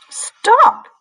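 high, loud screamed stop. Acted. Sparrow yelling at the slug, high loud scream. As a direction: scream it out like a startled bird. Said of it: "screamed a sparrow".

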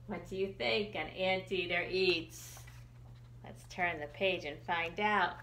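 ordinary delivery, nothing marked

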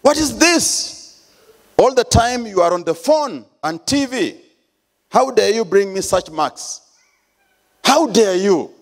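high, furious father yelling at his son; angry shout. Retold or imitated. Father scolding his son over report card, high angry shout. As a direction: blow up at the child over bad marks. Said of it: "started shouting".

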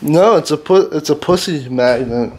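usual speaking voice

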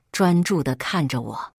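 plain reading voice

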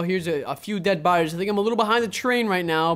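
usual speaking voice

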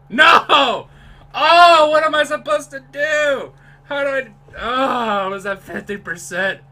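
loud, high frustrated wail; despairing protest. Real, not acted. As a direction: wail in exasperation, easing off at the end. Failed attempt, protesting the game.

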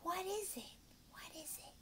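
hushed, secretive whisper; mysterious whispered question. Shown as a demonstration. Teacher demos question to child.

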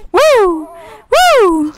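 high loud whoop of excitement; excited whoop. Real, not acted. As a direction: cheer out with a burst. Excited cheer while playing.

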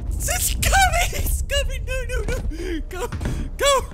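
high, loud panicked screams; scream, running for your life; panicking gamer. Real, not acted. Monster chases him.

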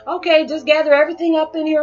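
high, loud, playful fluster; overwhelmed. Acted. Acts out being emotionally overwhelmed.

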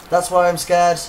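loud, high-pitched fright; startled, scared. Real, not acted. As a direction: blurt it out right after a jump scare. Just startled by the game.

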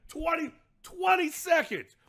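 loud amazed repetition; incredulous. Real, not acted. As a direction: hammer the punchline home. Repeating punchline in disbelief.

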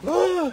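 long high mock cry; hammed-up child bursting into tears; imitated crumbling wail. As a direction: wail as if crushed by a scolding. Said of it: "he would crumble under a loud voice".

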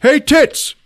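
high mock holler; calling out. Reggie to far-off gatherer, high holler. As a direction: call to someone way off. Hero calls to distant man.

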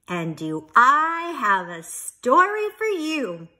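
lively, animated storybook reading; storyteller voice, loud and expressive; reading to children. Acted. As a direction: read it out big for little listeners. Reads picture book aloud to children.

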